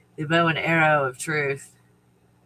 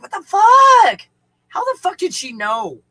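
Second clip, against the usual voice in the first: stunned outburst; 13 semitones higher, 10 dB louder than usual. Real, not acted. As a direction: blurt it out in total disbelief. Shocked at mom knowing.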